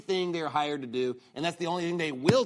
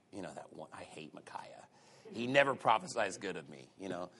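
grumbling king; 11 semitones lower, 9 dB quieter than usual. Retold or imitated. Voicing king hating Micaiah.

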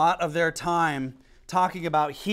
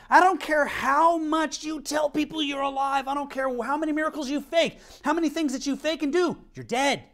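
fiery preaching; 8 semitones higher than usual. Acted. Preacher rebuking fake churches, fiery higher preaching. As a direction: hammer it home to the congregation.

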